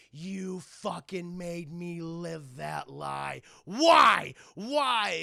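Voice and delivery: slow, then loud yelled why; furious demand. Retold or imitated. Bruce confronting the Hatter, furious shout. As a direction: demand an answer in rage.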